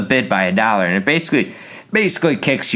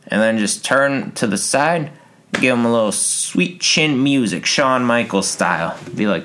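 ordinary delivery

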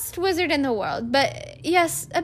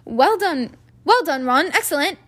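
pompous, higher prefect voice; 4 semitones higher, 5 dB louder than usual. A narrator's reading. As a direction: congratulate him self-importantly. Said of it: "said Percy Weasley pompously".

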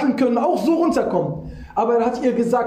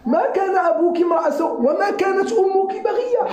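accusing cry; 8 semitones higher, faster than usual. Acted. People accusing Maryam.

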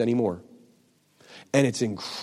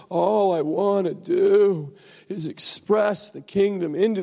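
high, drawn-out self-pitying whine; hammed-up "poor me" moaning; whining; 9 semitones higher, slower than usual. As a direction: whine pitifully, pitched well above normal. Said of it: "I just started whining".

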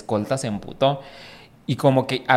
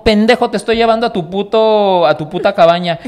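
exasperated; 8 semitones higher, 5 dB louder than usual. Retold or imitated. Narrador enojado con borracho.

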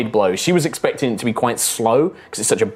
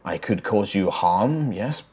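soft, low voice of a reluctant spirit; 4 semitones lower, 6 dB quieter than usual. Acted. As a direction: speak faintly, as a spirit inside the head. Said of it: "they say, like".

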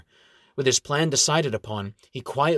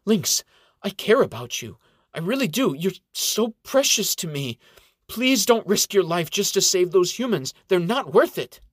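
emotional pleading; 7 semitones higher, 4 dB louder than usual. A narrator's reading. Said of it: "Xena raises her voice, welling up with emotion".

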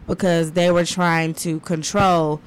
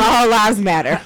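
louder mocking voice; 2 semitones higher, 6 dB louder than usual. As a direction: play a clueless person, hammed-up. Mocking racist stereotype.

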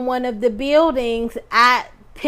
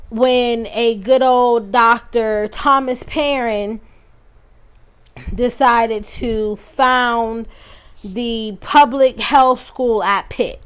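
slow sarcastic emphasis; 4 dB louder, slower than usual. Real, not acted.

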